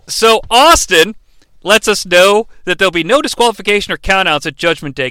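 ordinary talk, nothing marked

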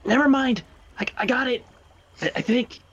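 high, unsure backpedal; high voice faking it's fine; Riley's nervous voice. Acted. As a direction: squeak out a shaky cover-up. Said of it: "and he's like".

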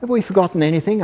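high, rapid elephant question. A narrator's reading. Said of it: "asked the elephant".

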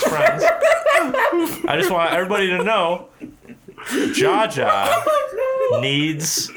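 laughing through a mock announcement. Real, not acted. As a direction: announce it while cracking up.

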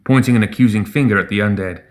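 plain reading voice

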